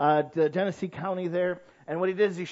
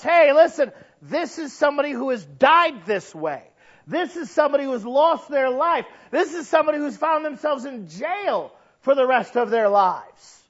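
loud, high lecturing warning; raised voice of an officer warning kids; officer's warning; 9 semitones higher, 9 dB louder than usual. Retold or imitated. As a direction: hammer home the warning like a cop at an assembly. Preacher voicing officer's warning.

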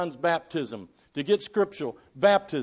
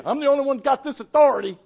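high, loud, quick mock boast; mock bragging; 8 semitones higher, 6 dB louder, faster than usual. Preacher voicing the boast John never made, mock bragging. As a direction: brag it out, raised and hurried. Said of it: "He didn't brag and say".

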